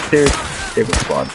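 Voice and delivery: ordinary delivery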